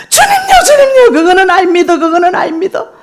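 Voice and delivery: high, loud sobbing plea; crying prayer. Retold or imitated. Mother weeping, pleading with the Lord. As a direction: beg through tears, voice breaking. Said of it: "하고 제가 막 울었습니다".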